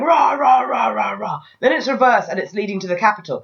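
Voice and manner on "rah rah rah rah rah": hammed-up orc roar; mock battle chant of orcs; orc war cries. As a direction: bellow it like a charging horde. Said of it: "hordes come through going".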